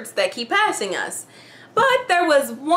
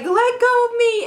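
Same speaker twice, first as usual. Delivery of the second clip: yelling; 11 semitones higher than usual. Retold or imitated. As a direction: yell it, struggling free. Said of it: "like, yelling".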